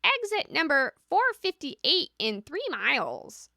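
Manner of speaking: parrot GPS voice. Shown as a demonstration. Showing the direct parrot GPS character to viewers. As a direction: bark the directions like a parrot navigator. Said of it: "Parrot G P S is very direct".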